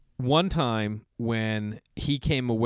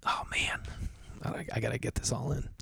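soft under-the-breath aside; quiet aside to himself; muttered aside; 8 dB quieter than usual. Real, not acted. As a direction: mutter to yourself while checking the time. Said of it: "let me see what time we got".

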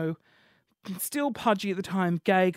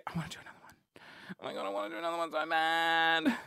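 eager whisper; 5 dB quieter, faster than usual. Real, not acted. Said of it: "whispered eagerness".

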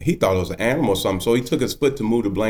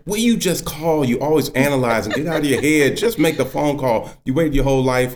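higher, impatient nagging; exasperated wife; 6 semitones higher than usual. Retold or imitated. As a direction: imitate a wife scolding her overthinking husband. Wife scolding him in retold call.

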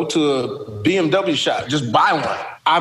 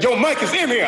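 high excited call from the back; mock hype call; 9 semitones higher than usual. Crowd guy warns the comedian, high excited call. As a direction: shout it like a hyped crowd guy. Crowd member calling out in club.

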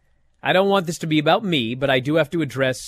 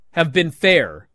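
normal talking voice

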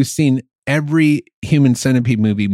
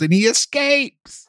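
high, drawn-out indignant cry; exasperated disbelief; 12 semitones higher, slower than usual. Real, not acted. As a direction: stretch it out in appalled disbelief. Mocking the American remake's ending.

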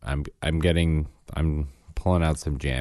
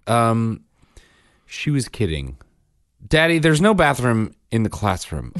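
imitating his son; 6 semitones higher, 6 dB louder than usual. Son's retort voiced by dad.

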